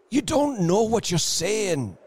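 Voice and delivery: high, distressed protest; unsteady. A narrator's reading. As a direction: plead unsteadily for sense. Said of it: "he said unsteadily".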